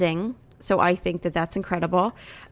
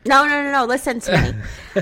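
loud, high repeated no; insistent interruption; 5 semitones higher, 5 dB louder than usual. Real, not acted. Wife stopping husband to be heard, loud and insistent. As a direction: cut in and demand attention.

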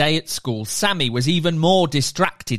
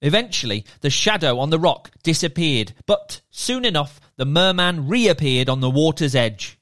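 ordinary delivery, nothing marked

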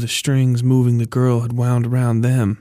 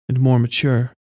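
ordinary delivery, nothing marked

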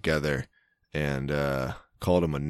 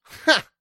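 short loud high ha; loud guffaw; 7 dB louder than usual. Shown as a demonstration. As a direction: blurt one loud ha. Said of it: "[name], give it to us now".

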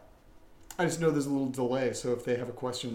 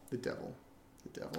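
hushed spooky repeat; 15 dB quieter than usual. Pretend. Repeating the title dramatically.

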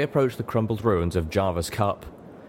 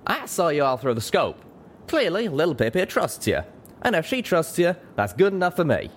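high, friendly cowboy voice; grinning; 9 semitones higher than usual. A narrator's reading. Pegasus accepts the zebra on friend's trust, grinning. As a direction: vouch for them, high and easy. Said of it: "still grinning".

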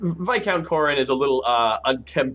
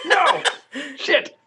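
high, laughing cry of dismay; yelped protest through a laugh; dismayed outcry; 12 semitones higher than usual. Pretend. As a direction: cry out high in mock despair. Reacting to the thrown staff.

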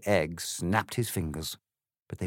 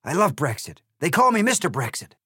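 loud, higher, boastful glee; cheered; 9 semitones higher, 7 dB louder than usual. Acted. The egg boasts happily to Alice, loud and high. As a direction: brag it happily. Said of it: "seemingly cheered".

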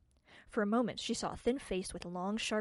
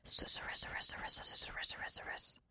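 breathy repeated whisper of the word; 8 dB quieter than usual. A narrator's reading. Narrator whispering the word susurrus to open the story. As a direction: hiss it softly like rustling leaves.

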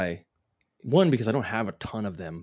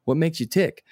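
eager schmoozer; 7 semitones higher, 3 dB louder than usual. Retold or imitated. Acting a networking small-talk guy.

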